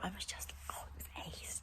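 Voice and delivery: hushed, breathy delight; awed whisper about a pony; whispered gush. Real, not acted.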